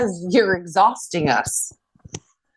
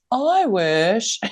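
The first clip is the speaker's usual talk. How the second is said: higher mock sighing wish; wistful hinting kid; 4 semitones higher than usual. Mom imitating her oldest son's hints, wistful. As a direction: imitate a child's swooping wish.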